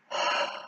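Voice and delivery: long relieved exhale; sigh of relief. Shown as a demonstration. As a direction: let out a slow sigh of relief. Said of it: "a sigh of relief".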